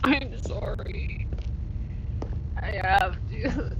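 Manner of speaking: slow breathy whisper of sorry; whispered apology. Real, not acted. Apologizing before jumping again.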